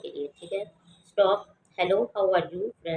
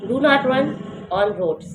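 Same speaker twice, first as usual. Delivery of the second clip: firm command with a falling finish; raised do not; 5 semitones higher, 5 dB louder, much slower than usual. Shown as a demonstration. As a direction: do not high and loud, roads falling. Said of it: "do not को ... rise करके पढ़ना है ... roads पे ... fall".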